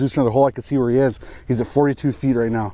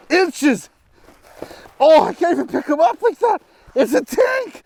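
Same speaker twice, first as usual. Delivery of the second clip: thrilled; 14 semitones higher than usual. Real, not acted. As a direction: shout with disbelief at the huge catch. Measuring the giant fish.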